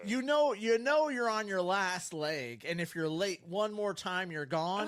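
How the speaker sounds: slow, loud, high-pitched warning, easing off; acted exasperated reprimand; exasperated scolding. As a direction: lay down the final warning, retold. Acted scolding of a late employee.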